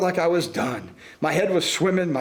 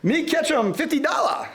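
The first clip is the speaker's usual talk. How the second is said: hammed-up coolie; 8 semitones higher than usual. A narrator's reading. Coolie voiced in story.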